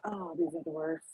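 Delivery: ordinary talk, nothing marked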